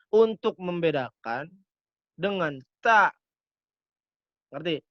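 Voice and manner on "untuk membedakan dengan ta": ordinary talk, nothing marked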